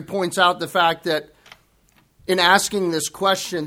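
ordinary talk, nothing marked

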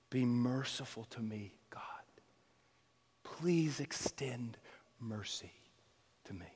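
a sinner whispering his plea for mercy; whispered plea. Acted. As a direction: beg God for mercy in a hush.

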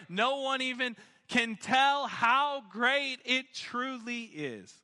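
high, building preacher's proclamation; emphatic preaching. Real, not acted. Preaches on the inexpressible gift.

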